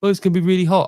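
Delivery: hammed-up moaning customer; mocking moaner. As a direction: shop worker moaning about the heat, whiny and mock. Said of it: "She's like".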